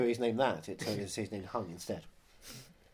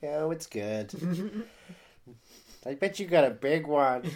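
leering dad voice, louder on the last line; half-laughing creepy dad, hammed up; mock creepy dad; 4 semitones higher, 5 dB louder than usual. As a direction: play the creepy dad with a grin.

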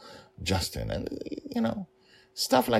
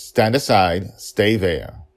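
forceful; 3 semitones higher, 6 dB louder, slower than usual. Acted. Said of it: "was forceful enough".

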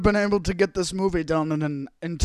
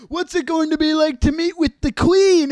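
mock interviewer; 8 semitones higher, 4 dB louder than usual. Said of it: "And then they're like".

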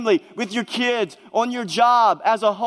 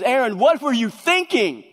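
exasperated Moses; 5 semitones higher than usual. Retold or imitated. Moses confronting Aaron over the calf, exasperated and incredulous. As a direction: scold your brother in disbelief. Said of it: "most comical sections in all of Scripture".

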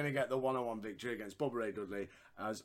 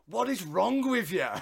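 louder, higher, slow exasperated cry; mock exasperation; 6 semitones higher, 7 dB louder, slower than usual. Bubba yelling at Rico after the smother, exasperated mock cry. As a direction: bellow it at Rico in disbelief. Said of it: "Bubba turns it to Rico and goes".

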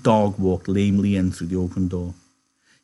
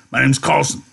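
loud, raised big-man voice; Carlson; 5 semitones higher, 6 dB louder than usual. A narrator's reading. Big man introducing himself to newcomers, loud and hearty. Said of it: "the big man said".

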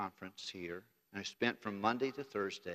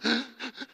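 high sobbing catch of breath; sharp tearful gasp; 12 semitones higher, 3 dB louder than usual. Retold or imitated. Boy overwhelmed at the altar, gasping mid-sob. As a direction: gasp in as if fighting back tears.

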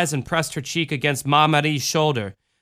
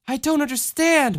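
put-on whimpering girl; 12 semitones higher than usual. A narrator's reading. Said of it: "Michelle whimpered".

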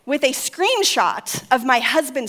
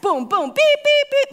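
booms into high beeps; imitated phone alerts; 14 semitones higher, 5 dB louder than usual. Phone blaring with blank texts, imitated beeps.

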